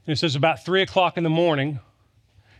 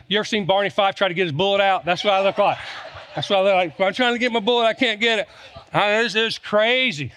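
hammed-up panic, voice pitched up; frantic fumbling; 5 semitones higher than usual. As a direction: act out fumbling for the bullet in a panic. Preacher acting out panicked Barney Fife.

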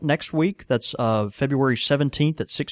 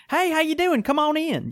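much higher, sing-song welcome to customers; a cheery, pitched-up hello at the door; high shop greeting. Retold or imitated. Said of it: "it's me going".

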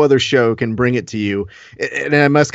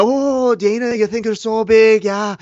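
higher mocking fighter voice, put on; mock Conor; 6 semitones higher than usual. Boss mimicking his fighter in a higher mocking voice. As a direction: whine it back like a cocky fighter.

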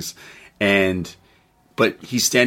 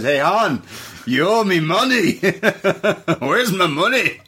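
Scottish gangster; 9 semitones higher than usual. Retold or imitated. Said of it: "this Scotsman shows up and says".

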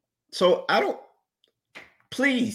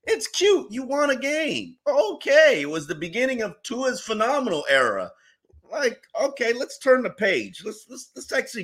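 much higher, condescending mock praise; 11 semitones higher than usual. Host mocking cohost over last year's win, sneering higher. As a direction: pat them on the head, sing-song higher.

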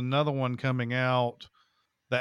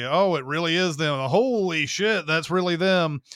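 higher, louder mock amazement; astonished double take; 4 semitones higher, 5 dB louder than usual. Viewer recognizing the actors, mock astonished. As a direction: act out a stunned realization.

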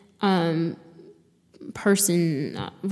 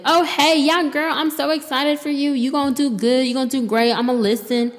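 put-on higher, gushing hype-girl voice; mock fake-supportive friend; 6 semitones higher than usual. Mocking friends who promise to listen, higher gushing voice. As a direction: gush empty promises like a flaky friend.

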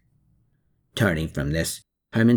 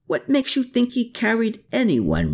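cried out; 13 semitones higher than usual. A narrator's reading. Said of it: "Riedel cried out".

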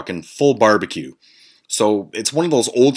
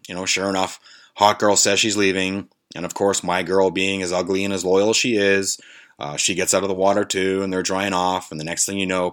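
usual speaking voice

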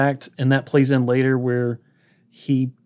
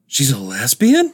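incredulous mom. Retold or imitated. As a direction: imitate a taken-aback mother, drawing it out. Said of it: "And the mom is like".

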